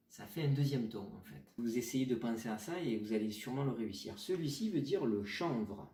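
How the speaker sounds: ordinary talk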